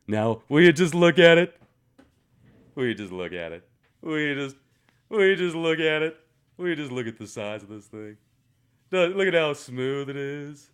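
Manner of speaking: a hammed-up salesman marvelling at it; mock showman. Showing viewers his polished find in a hammed-up showman voice.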